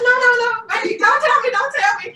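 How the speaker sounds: high, loud pleading no; excited pleading. Retold or imitated. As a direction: beg them not to spoil it. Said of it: "I said".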